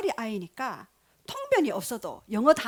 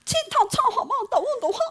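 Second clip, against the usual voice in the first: very high singsong fake Chinese, laughing; mock Chinese tongues; 11 semitones higher, 4 dB louder than usual. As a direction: imitate a burst of Chinese tongues. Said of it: "중국어로 막 방언을". (높은 톤의 중국어 흉내)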